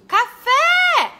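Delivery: Café yelled high and loud, the FÉ held long; teacher yelling to show the strong syllable; shouted word. Shown as a demonstration. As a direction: yell Café and hold the last syllable. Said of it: "onde é que eu prolongo a minha voz quando eu grito".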